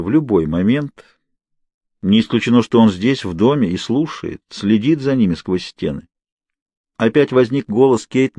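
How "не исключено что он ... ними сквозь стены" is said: plain reading voice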